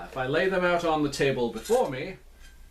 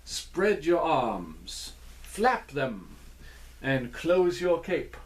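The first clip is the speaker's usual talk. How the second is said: slow dance-step calling; slower than usual. Shown as a demonstration. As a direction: call each dance move slowly. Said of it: "try out those steps".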